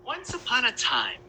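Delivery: usual speaking voice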